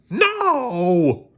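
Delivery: very high cartoon scream. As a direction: explode with frustration. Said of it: "Bob screamed".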